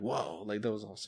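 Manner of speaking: soft, low, hushed wonder; hushed awe. Retold or imitated. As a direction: breathe it out at a shooting star. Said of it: "I'm like".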